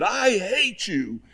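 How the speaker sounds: high, drawn-out yell at a mountain; retold boy's yell, stretched out and high-pitched; mock yell. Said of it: "he yelled as loud as he could".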